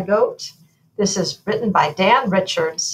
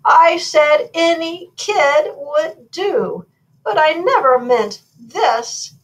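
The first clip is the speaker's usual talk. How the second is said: slow, loud, higher storybook lament; wailing; 9 semitones higher, 6 dB louder, slower than usual. A narrator's reading. King regrets saying any kid would do, acted slow wail. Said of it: "wailed the king".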